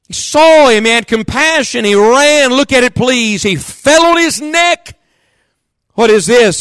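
exultant storyteller preacher; preaching at full force. Acted. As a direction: paint the father's run with rising joy.

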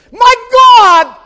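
high, drawn-out scream; desperate shout. Acted. Jesus as the Trinity is severed, desperate shout. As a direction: tear it from the throat. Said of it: "And then he says".

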